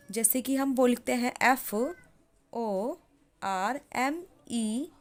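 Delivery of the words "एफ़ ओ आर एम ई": slow dictation spelling. Shown as a demonstration. Spelling out letters.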